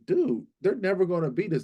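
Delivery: high-pitched, dismissive retold quote; cocky disbelief. Fan recalling what he told family, cocky disbelief.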